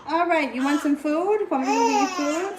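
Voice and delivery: high coaxing voice over the crying. Real, not acted. Offering crying baby food.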